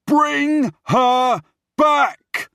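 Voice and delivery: very high, drawn-out full shout; furious shout. Acted. Prince cutting off his brother's protest, furious shout. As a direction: bellow each word slowly. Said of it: "I shout".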